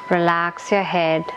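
ordinary talk

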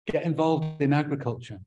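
normal talking voice

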